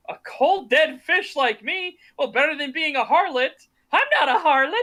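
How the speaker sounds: mock indignation. Insulted woman retorts in the retold fight, mock indignant. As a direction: throw the insult back, hammed-up offence. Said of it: "they start getting into a fight".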